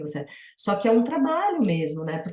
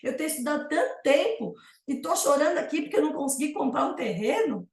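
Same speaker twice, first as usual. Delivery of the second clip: high, fast self-reproach, half laughing; self-mocking inner voice; 7 semitones higher than usual. Retelling her own frustration over the lost land, high and fast. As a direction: scold yourself in mock disbelief. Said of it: "assim, tipo".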